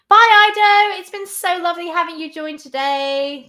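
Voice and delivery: high, loud sing-song bye; loud high farewell to Ido; cheery goodbye. Real, not acted. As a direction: call out a bright goodbye. Waving off a viewer.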